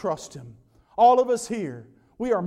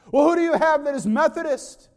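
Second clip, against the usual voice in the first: mock call to Saint Peter; 7 semitones higher, 5 dB louder than usual. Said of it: "mocked this idea of God".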